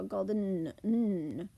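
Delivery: "golden" with the EN swallowed into a held n; barely any vowel before the final n in "golden"; swallowed ending. Shown as a demonstration. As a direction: say "golden" as gold-nn, humming the last n. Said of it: "it's almost a swallowed sound".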